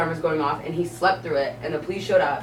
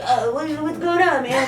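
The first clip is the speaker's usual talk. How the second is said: much higher, dazed and laughing stoner voice; startled stoner; 9 semitones higher than usual. Retold or imitated. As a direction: play the clueless high guy caught off guard. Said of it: "And he was like".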